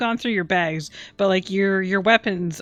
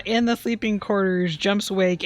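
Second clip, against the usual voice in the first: ordinary talk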